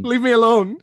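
high, loud mock protest; mock outburst. Host playing the pestered auditionee, loud mock outburst. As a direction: cry out in pretend exasperation.

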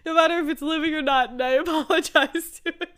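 high, loud, laughing exclamation; cracking up while admitting it; laughing confession. Real, not acted. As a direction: blurt it out through real laughter. Laughing confession about apologizing to objects.

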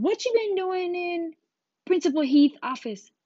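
very high, loud interrogation; hammed-up scolding, voice shooting up; outraged teacher. Teacher to pupil back from principal's office, outraged.

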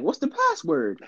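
put-on high teasing girlfriend voice, pitched well up. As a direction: ask it like his date playing coy. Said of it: "she be like".

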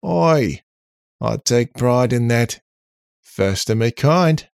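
high, loud, slow and proud; proud robot character. Acted. Robot answering an insult from Bill, proud and loud. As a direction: protest loudly, drawing out the boast.

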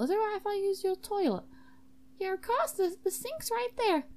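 high-pitched, put-on politeness; high character voice. Guest asking a student host for the toilet, high voices. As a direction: keep the voice high and helpful.

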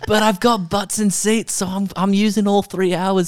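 high, laughing boast; giddy mock-proud playwright; laughing bravado. Joking as the playwright, high and laughing.

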